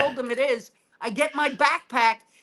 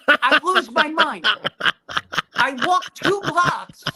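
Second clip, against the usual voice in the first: ordinary talk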